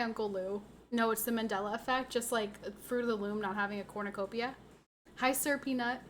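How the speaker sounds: usual speaking voice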